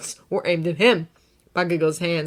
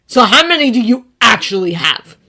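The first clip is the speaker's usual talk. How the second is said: louder, higher, grating demand; grating question; 5 semitones higher, 12 dB louder than usual. A narrator's reading. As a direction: press him with a grating edge. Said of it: "his tone grating".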